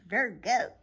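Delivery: ordinary talk, nothing marked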